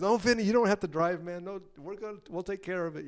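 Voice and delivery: high-pitched, hasty reassurance; mock placating. Bandmates keeping terrible driver off the wheel, mock reassurance. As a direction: politely talk him out of driving.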